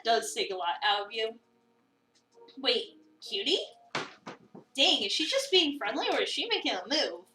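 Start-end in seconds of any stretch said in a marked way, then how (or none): none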